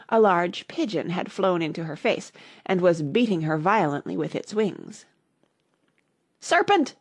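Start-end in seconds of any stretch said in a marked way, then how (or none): 6.4-6.9 high-pitched storybook scream; sudden high cry of accusation. A narrator's reading. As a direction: shriek it as an alarmed bird. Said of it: "screamed the pigeon".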